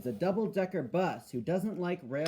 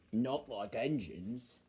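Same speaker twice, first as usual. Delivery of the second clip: slow, low, quieter bus voice; Bulgy; 6 semitones lower, 5 dB quieter, slower than usual. Acted. Bulgy sneering at engines, slow and low. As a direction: add the dig slowly, low down. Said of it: "said Bulgy".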